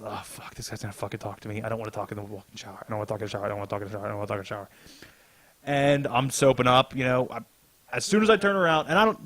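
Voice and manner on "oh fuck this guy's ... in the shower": low, much softer, fast muttering; hushed fast inner panic; panicked inner voice. Retold or imitated. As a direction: grumble it under your breath in dread. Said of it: "I goes".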